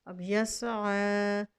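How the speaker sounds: यसआ very slow; recited word. Shown as a demonstration. Teacher recites the word slowly. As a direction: draw out यसआ. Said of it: "ऐसे ही वक्फ की हालत में पढ़ा जाएगा".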